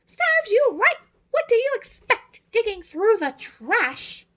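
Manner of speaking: high, loud, nasty jeering; grouchy scolding. Acted. The Grouch jeering at cut-up Steve, loud and spiteful. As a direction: snap it at him like a spiteful old neighbour.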